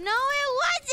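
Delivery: put-on high, slow baby dragon voice. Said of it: "it's my voice".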